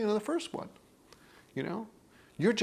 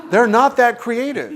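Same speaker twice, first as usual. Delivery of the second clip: higher, louder, slow, laughing through it; 8 semitones higher, 11 dB louder, slower than usual. Real, not acted. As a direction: chuckle it out, amused. Amused at predictable stories.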